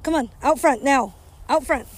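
loud, high commands to a dog; yelling at the dog. Real, not acted. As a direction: bark orders at the dog outside.

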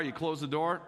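normal talking voice

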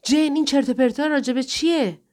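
exasperated, high-pitched yell; angry shout. A narrator's reading. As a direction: shout it as an outraged demand. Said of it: "مایکل داد زد".

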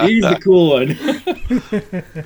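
giggling it out as he denies being cool; real laughter breaking up the words; laughing. Real, not acted.

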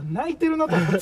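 can't hold back the laughter while telling it; laughing. Real, not acted. (笑いながら)

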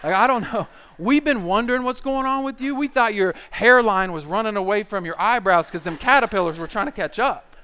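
loud, mocking pile-on; roast session. Guys roasting their buddy about his forehead, mocking. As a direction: ride over him with big punchy jabs. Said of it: "Roast session begins immediately".

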